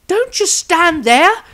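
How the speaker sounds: high, loud, slow woman's protest; protesting holy woman. Acted. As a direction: urge the frozen wizard to act. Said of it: "protested the holy woman".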